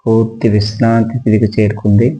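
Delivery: ordinary talk, nothing marked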